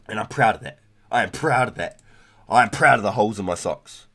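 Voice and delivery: loud, proud and defiant; proud declaration. Real, not acted. Boasting loudly to friends about his holey socks. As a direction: announce it with loud pride.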